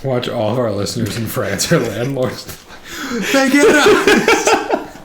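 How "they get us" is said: they get us! cried out high and loud, laughing; laughing shout. Real, not acted. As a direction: burst out laughing high and drawn out. Laughing joke about French listeners.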